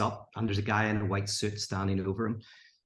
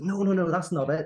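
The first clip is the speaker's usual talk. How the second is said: higher-pitched agitated objection; exasperated, raised-pitch retort; agitated protest; 8 semitones higher, 4 dB louder than usual. Retold or imitated. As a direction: act out a frustrated man protesting. Acted protest of trapped gambler.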